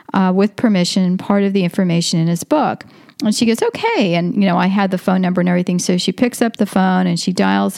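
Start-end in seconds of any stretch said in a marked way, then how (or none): 3.6-4.1 high, sing-song okay; light, high-pitched imitation of an upbeat reply; chirpy retold okay. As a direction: pitch it way up, then drop. Retold quote of cheerful friend.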